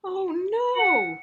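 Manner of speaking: exaggerated cry for the kids; big oh no. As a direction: reader showing dismay at the sleeping cat, hammed-up. Cat falls asleep in tree.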